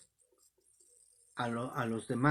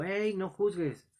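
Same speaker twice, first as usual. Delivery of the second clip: higher-pitched mock yell; retold shout; 5 semitones higher than usual. Young man interrupts the preacher on the bus, retold shout. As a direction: imitate a heckler calling out, not at full volume. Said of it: "me gritó y me dijo".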